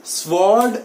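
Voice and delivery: "स्वॉर्ड" with the w sound exposed; wrong sword. Shown as a demonstration. Teacher points out the unwanted sound, w sounded. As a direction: stretch out SVORD for "स्वॉर्ड". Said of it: "sound नहीं आना चाहिए".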